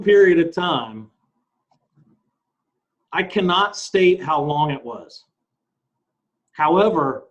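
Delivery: slow, deliberate dramatic reading; read-aloud. Acted. Co-host reads Jesus's answer aloud, slow read-aloud. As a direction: read out the testimony slowly, phrase by phrase.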